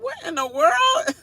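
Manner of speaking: high shocked cry of disbelief; mock shocked. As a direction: squeal it in stunned disbelief. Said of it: "I was like".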